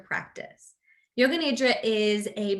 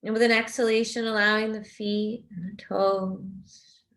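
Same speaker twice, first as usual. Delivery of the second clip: guided meditation. Real, not acted. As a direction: guide them gently into rest, unhurried and even.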